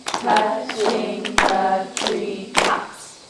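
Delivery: slow, syllable by syllable chant; clapping out syllables. Shown as a demonstration. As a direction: chant each syllable to a clap. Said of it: "we're gonna clap out these five syllables".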